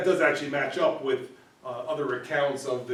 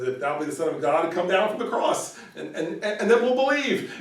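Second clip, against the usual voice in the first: rising, louder jeering challenge; mocking taunt; 5 semitones higher, 5 dB louder than usual. Mocker taunting Jesus on the cross, jeering and rising. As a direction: dare him to come down, sneering.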